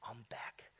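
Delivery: hushed whispered vow. Acted. Climber whispering to the mountain, hushed. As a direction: breathe it to the mountain.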